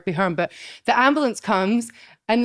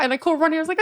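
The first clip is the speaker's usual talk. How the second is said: normal talking voice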